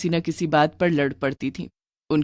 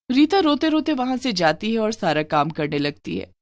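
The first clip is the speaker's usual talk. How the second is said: usual speaking voice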